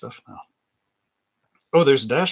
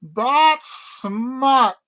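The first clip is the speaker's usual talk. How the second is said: put-on high, loud disapproving old lady voice; mom scolding; 12 semitones higher, 7 dB louder than usual. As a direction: sniff it out like a prudish mother.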